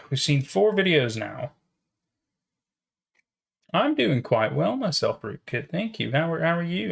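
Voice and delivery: ordinary delivery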